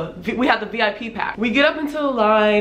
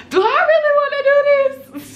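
very high squeal of dread; mock panic; 4 dB louder than usual. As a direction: squeak it out like you badly want to back out. Retelling panic in the waiver line.

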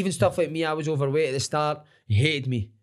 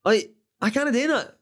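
mock protest; 11 semitones higher than usual. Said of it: "And I'm like".

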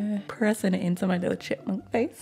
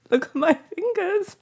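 high, giggly and loud; laughing squeal; 13 semitones higher, 4 dB louder than usual. Real, not acted. Laughing at her own face.

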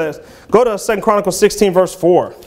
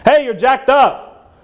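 mock rebuke; 7 semitones higher, slower than usual.

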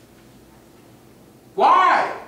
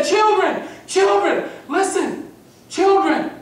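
put-on calling out to children; 6 semitones higher, slower than usual. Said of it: "he addressed and said".

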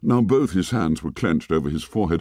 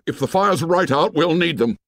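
shouting; 8 semitones higher than usual. A narrator's reading. Ralph shouts back down the mountain, urgent and high. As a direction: keep the shout going, urgent. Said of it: "He stopped shouting".

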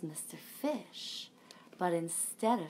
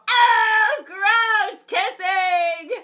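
much higher, much louder shout of disgust; kids grossed out; 13 semitones higher, 14 dB louder, much slower than usual. Retold or imitated. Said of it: "They all go".

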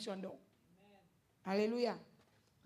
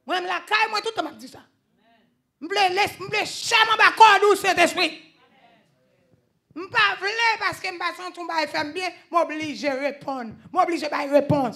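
loud, high-pitched preaching; full-voiced sermon build; preaching at full force; 7 semitones higher, 10 dB louder than usual. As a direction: preach it out to the congregation.

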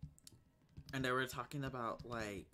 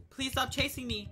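loud pleading; 10 semitones higher, 6 dB louder than usual. Real, not acted. Pleading while chased in game.

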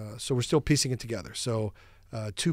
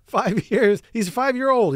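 exasperated protest; 10 semitones higher, 7 dB louder than usual. Real, not acted. Protesting loudly, laughing.

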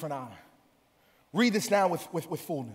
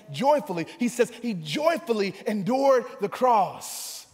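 raised sermon cry on each phrase; full-force preaching; 6 semitones higher, 6 dB louder than usual. Real, not acted. Preacher proclaiming the joy before the cross, full-force preaching. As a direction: stretch it out, then climb to a shout.

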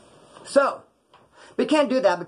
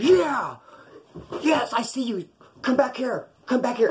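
calling out across the room; 8 semitones higher, slower than usual. Retold or imitated. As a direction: shout across the dorm at an old enemy. Silver calls out across dorm.

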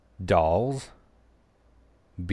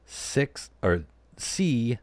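ordinary talk, nothing marked

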